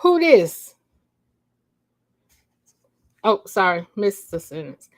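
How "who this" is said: high, loud and sharp; rude demand. Acted. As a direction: demand it like a stranger on the line. Caller demanding on phone.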